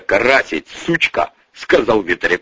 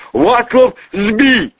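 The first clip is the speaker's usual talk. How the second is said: high, loud breathless alarm; urgent calling; 13 semitones higher, 4 dB louder, much slower than usual. Acted. Bodyguard bursts in to warn the boss, calling urgently.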